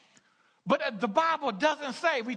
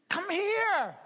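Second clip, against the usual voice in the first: calling voice; 8 semitones higher than usual. Retold or imitated. God calling.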